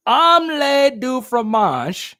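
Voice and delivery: "Omelette du fromage" slow and high, with big cartoon confidence; slow, overconfident fake French; confident mock French. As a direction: proudly sound out "Omelette du fromage" like the cartoon kid. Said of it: "The confidence was there".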